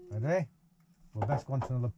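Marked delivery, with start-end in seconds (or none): none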